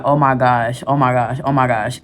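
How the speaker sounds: low, hushed panic under breath; panicked low murmur. Retold or imitated. Heart racing, panicked whisper.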